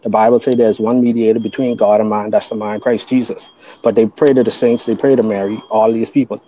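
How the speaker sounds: normal talking voice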